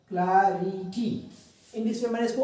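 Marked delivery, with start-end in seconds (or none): 0.1-1.1 clarity slowly split into syllables. Shown as a demonstration. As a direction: break clarity into spaced syllables. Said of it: "अलग-अलग syllables को". (Clarity को अलग-अलग syllable में, धीरे)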